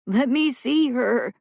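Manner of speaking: slow desperate plea; begging wheeze. A narrator's reading. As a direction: plead through a weak wheeze. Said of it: "his voice barely a wheeze".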